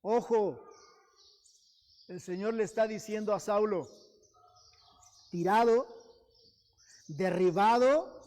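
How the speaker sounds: preaching at full force. Acted. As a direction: proclaim it like a command from above. Preaching climax.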